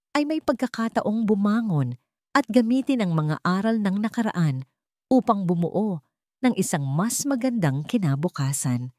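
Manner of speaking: ordinary delivery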